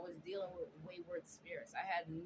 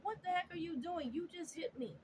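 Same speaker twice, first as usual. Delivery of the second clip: high, stunned cry of disbelief; retold high-pitched shocked yelp; shocked outcry; 9 semitones higher than usual. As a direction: blurt it out in shock at being hit. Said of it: "I literally was like".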